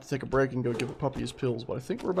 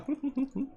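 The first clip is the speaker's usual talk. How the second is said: short laugh; 12 semitones higher, 4 dB quieter than usual. Real, not acted.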